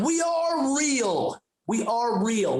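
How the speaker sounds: high, slow, emphatic start; mock parental insistence, stretched out; indignant parent insisting. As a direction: hammer it home like an exasperated parent. Parents insist they are real.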